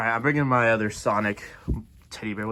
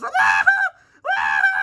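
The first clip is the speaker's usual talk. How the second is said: loud repeated shrieks; terrified screaming; 6 dB louder than usual. Acted. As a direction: shriek as the ride drops. Screaming on the ride.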